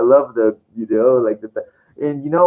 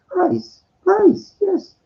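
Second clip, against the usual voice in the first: awed cry; much slower than usual. Retold or imitated. Mock awed exclamation in story.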